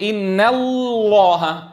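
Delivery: copied faulty "إن الله", long lam changing from light to full; light-then-heavy lam. Retold or imitated. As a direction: imitate reciters' slow "إن الله" with a thin lam swelling to heavy. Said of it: "بيبدأ بلام مرققة".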